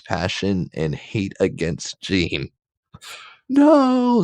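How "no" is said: very high, loud mock scream. Jean screams as Scott kicks Logan, very high and loud. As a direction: shriek it in hammed-up horror. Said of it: "screamed again Jean".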